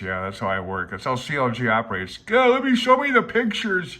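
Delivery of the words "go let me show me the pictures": much higher, louder, pleading "show me the pictures"; mock childish demand; impatient whine. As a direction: beg for the pictures like a kid. Mimicking own impatient self.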